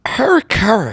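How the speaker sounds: lightly mocked sports announcer, pitched high; Will Ferrell's announcer bit. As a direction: imitate a sketch announcer, high-voiced. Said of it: "Chicago Cubs announcer".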